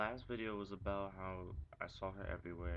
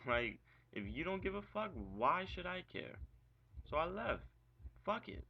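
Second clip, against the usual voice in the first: heated rant; 9 semitones higher than usual. Real, not acted. Ranting about leaving the call.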